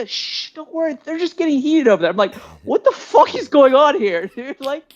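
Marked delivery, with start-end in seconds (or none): 0.1-2.1 high-pitched imitated shushing; mock reassurance. Shushing him, reassuring, in retold quote. 2.6-4.9 high-pitched incredulous questioning; retold alarm. Retold alarm at trap house.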